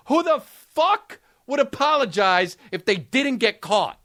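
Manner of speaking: normal talking voice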